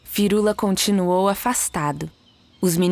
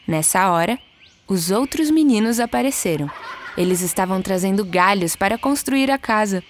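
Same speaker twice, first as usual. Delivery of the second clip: usual speaking voice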